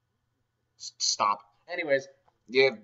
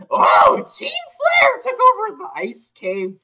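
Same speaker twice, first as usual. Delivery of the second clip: much higher, louder, slow alarmed call; mock alarmed cry; 12 dB louder, slower than usual. NPC alarmed about the ice cave, high mock cry. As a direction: cry out the bad news.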